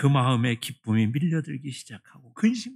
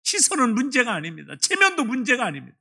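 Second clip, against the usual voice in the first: raised, emphatic preaching; 8 semitones higher, 4 dB louder than usual. Acted. Preacher pressing the point to the congregation, raised.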